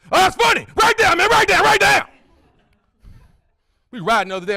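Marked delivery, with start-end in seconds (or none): 0.1-2.1 high, loud, overexcited yelling; excited friend. Retold or imitated. Friend yelling at comic to write jokes down, overexcited. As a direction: hype it up like an overeager buddy. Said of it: "he get excited".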